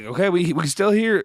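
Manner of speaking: a light impression of a Southern US politician; Southern accent. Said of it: "he has this very, like, Southern accent".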